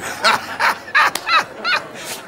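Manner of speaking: high wheezing cackle; helpless laughter. Real, not acted. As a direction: burst out laughing at the blunder. Cracks up at the mistake.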